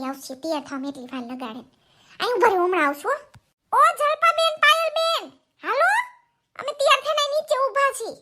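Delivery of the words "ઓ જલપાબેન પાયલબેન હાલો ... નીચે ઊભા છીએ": higher voice calling up to the neighbours. Acted. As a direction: call them down from the street. (નીચેથી પડોશીને બૂમ પાડીને બોલાવવું)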